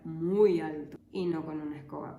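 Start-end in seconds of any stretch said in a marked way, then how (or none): 0.0-1.0 louder, very slow stretch on "muy alto"; long, emphatic "muy alto"; drawn-out emphasis. Real, not acted.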